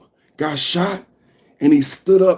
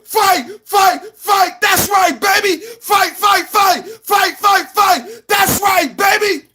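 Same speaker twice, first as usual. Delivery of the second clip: rally cry; 14 semitones higher, slower than usual. Retold or imitated. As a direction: chant it like a triumphant rally. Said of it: "he stood up and he said".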